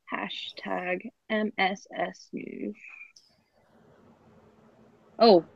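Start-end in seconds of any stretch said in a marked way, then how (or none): 0.1-2.8 whispered. Real, not acted. Reading handle in a whisper.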